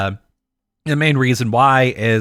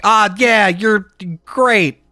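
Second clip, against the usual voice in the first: mock fan; 6 semitones higher than usual. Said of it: "anime fans are like".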